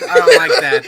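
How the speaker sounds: high, loud playful protest; mock outraged squeal. Real, not acted. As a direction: squeal it in fake offense. Mock offense over stolen name.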